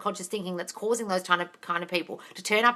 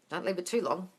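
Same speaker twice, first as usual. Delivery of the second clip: ordinary delivery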